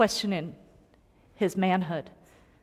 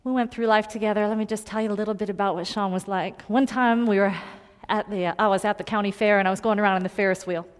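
ordinary talk, nothing marked

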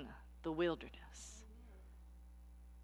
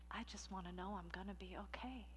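softer, fading longing; hushed; 17 dB quieter than usual. Acted. The weary soul wishing to be okay, hushed. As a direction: murmur it almost to yourself. Repeated line, first take softer.

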